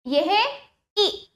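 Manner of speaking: usual speaking voice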